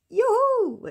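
high, loud celebratory cheer; delighted whoop. Real, not acted. Knitter celebrating a finished project, delighted whoop. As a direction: whoop it out in triumph. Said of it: "je suis trop contente".

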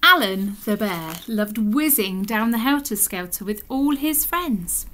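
ordinary delivery, nothing marked

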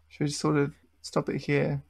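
ordinary delivery

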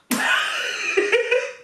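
rire aigu sans voix; éclate de rire à ta propre bourde. Real, not acted. Rit de sa bourde. (rire aigu et soufflé)

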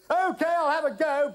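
loud, high bellowed reply. A narrator's reading. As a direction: bellow back over the din. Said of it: "bellowed Algy".

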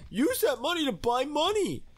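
very high, squeaky self-mockery; mocking himself. Real, not acted. Mocking his own idea of buying money, high-pitched ridicule. Mocks what he just said.

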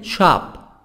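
SHOP with open 'ah', American style; American-accented 'shop'; American pronunciation. Shown as a demonstration.

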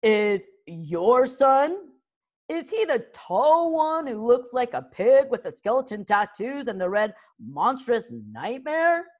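mocking chief. A narrator's reading. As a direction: taunt the rival chief about his son. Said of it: "asked Mugadon the Meathead".

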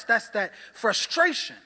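usual speaking voice

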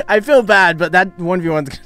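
loud, high, amused guilt; laughing sympathy. Real, not acted. Streamer feeling bad for a hapless opponent, laughing loudly. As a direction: burst out through a laugh.

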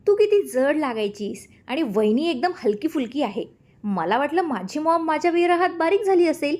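high, cheeky teasing voice; mischievous teasing. A narrator's reading. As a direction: needle his mother playfully. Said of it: "दीप खट्याळ हसत म्हणाला".

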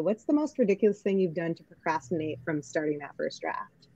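ordinary talk, nothing marked

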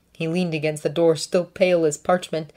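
plain reading voice

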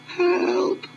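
very high, breathless cry for help; weak gasp. Acted. Fallen sixteen gasps weakly for help, very high. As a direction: gasp it out after the fall. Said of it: "he gasped weakly".

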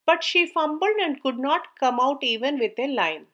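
usual speaking voice

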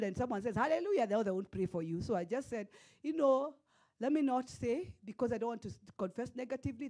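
normal talking voice

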